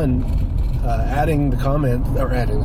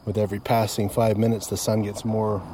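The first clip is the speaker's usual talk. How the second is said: calm and soft; 3 semitones lower, 7 dB quieter than usual. Real, not acted. Calm sunset update, softer.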